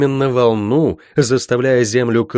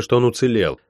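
ordinary delivery

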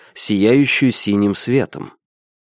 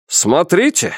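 higher-pitched captain's shout; alarmed cry; 6 semitones higher, slower than usual. A narrator's reading. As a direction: cry out a warning, pitch jumping up. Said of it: "закричал капитан".

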